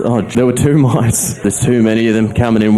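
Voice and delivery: normal talking voice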